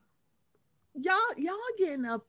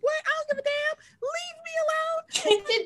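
much higher, crying out, the last words slow and stretched; mock panicked shriek. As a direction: squeal it like someone begging to be left alone. Voicing panicked reaction to mime.

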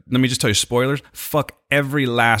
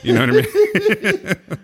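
laughing; 10 semitones higher, 3 dB louder, slower than usual. Real, not acted. Laughing while asking Steve.

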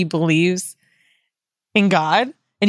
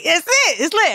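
high hyped-up cheer; high excited it's lit; 8 semitones higher than usual. Retold or imitated. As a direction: celebrate it, higher. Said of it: "We was like".

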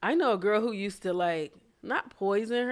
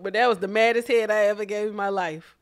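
ordinary talk

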